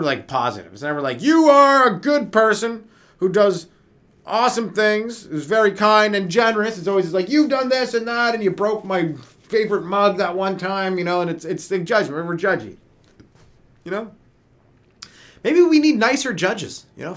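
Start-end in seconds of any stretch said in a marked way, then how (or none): 1.2-6.8 high, slow, syrupy compliment; mock sugary praise. 7.3-11.0 mock accusation. 15.4-16.8 excited idea. Real, not acted.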